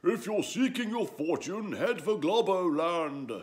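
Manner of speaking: slow call across the distance; calling after. Acted. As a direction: wizard calling out advice as they set off. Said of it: "the wizard called after them".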